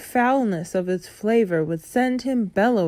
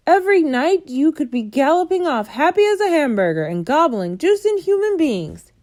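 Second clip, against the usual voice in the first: higher, loud ranting; raging giant; 9 semitones higher, 5 dB louder than usual. A narrator's reading. Bloodbottler ranting at the BFG, loud and high. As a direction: rant in disgust.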